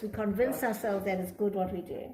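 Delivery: ordinary talk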